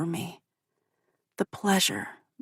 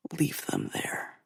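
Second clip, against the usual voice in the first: slow whisper in the ear; 3 semitones lower, 9 dB quieter, slower than usual. A narrator's reading. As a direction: breathe it slowly into her ear. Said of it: "he whispers in my ear".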